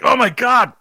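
very high, loud mock horror; hammed-up revulsion; disgusted outcry. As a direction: shriek it like grossed-out friends. Acts friends' disgust at smell.

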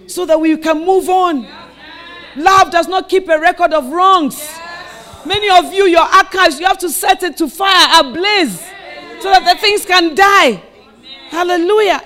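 loud, high preaching shout; fervent sermon climax, shouted; full-force preaching. Acted. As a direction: preach it out to the crowd.

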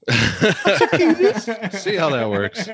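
laughing. Real, not acted. Laughing after a joke.

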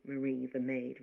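usual speaking voice

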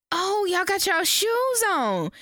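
high, slow, fussing voice; mother scolding about shoes, high and slow. Retold or imitated. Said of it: "talking about".

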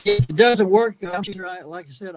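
ordinary delivery

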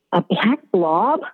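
slow, disbelieving rise on blob; puzzled question. A narrator's reading. Narrator echoing the odd sight, puzzled. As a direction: ask it slowly, not believing your eyes.

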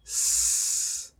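soft, pure hiss of air; only air, no voice: sss; voiceless hiss. Shown as a demonstration. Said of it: "¿Lo practicamos?".

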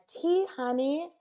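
sweet housewife. Retold or imitated. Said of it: "and his wife's like".

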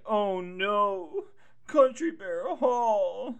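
theatrical mock sorrow; mock wail. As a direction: fake despair over the toy hall. Mock dismay at crushing.

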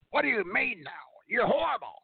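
high, outraged mock boss voice; hammed-up imitation of an indignant boss; Vince McMahon. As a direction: flip into shrieky, offended disbelief. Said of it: "that's exactly what Vince McMahon would do".

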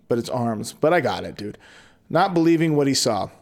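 usual speaking voice

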